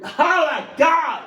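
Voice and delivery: high, loud shout of horror; real anguished yell; emotional outburst. Real, not acted. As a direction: burst out in overwhelmed disbelief. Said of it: "I'm getting a little emotional".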